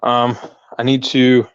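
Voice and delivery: breathless, catching breath between words. Real, not acted. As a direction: talk while still winded. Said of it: "I'm out of breath".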